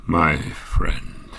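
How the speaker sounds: ordinary delivery, nothing marked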